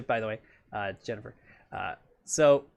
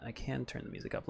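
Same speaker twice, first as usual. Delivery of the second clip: softer, fast whispered aside; 4 semitones lower, 14 dB quieter, faster than usual. Real, not acted. Checking the music level for viewers, whispered aside. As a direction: mutter it to yourself, unvoiced.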